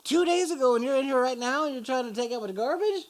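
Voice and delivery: high, loud disbelief, speeding up at the end; can't believe he's here working; incredulous. Retold or imitated. Retold disbelief at stabbed guy.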